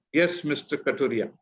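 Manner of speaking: ordinary talk, nothing marked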